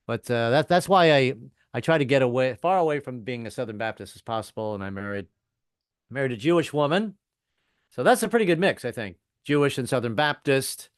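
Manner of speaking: normal talking voice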